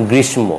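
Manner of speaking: গ্রীষ্ম with m after "sh"; quoted mistaken গ্রীষ্ম, ending "mo"; sounded m. Retold or imitated. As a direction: say গ্রীষ্ম as "grish-mo". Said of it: "গ্রীষ্ম হবে না".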